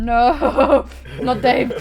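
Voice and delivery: loud no through laughter; laughing protest. Real, not acted. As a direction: protest while cracking up. Laughing protest at a silly name.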